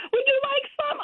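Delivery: mock the sweet sales pitch; imitating a fair lady offering samples. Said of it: "She goes".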